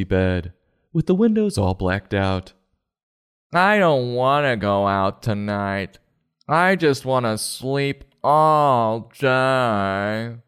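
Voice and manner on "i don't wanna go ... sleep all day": slow, whiny half-asleep mumble; sleepy groundhog voice. Acted. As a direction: drag every word out like you just woke up. Groundhog voiced refusing to get up.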